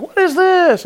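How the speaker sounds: high-pitched startled question; acted astonishment. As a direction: play baffled wonder, voice leaping up. Moses startled by burning bush.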